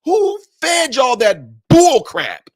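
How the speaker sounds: slow, high, loud shout of disbelief; drawn-out indignant shout; outraged shout. Real, not acted. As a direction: yell it slowly at them in outrage. Outraged challenge to critics.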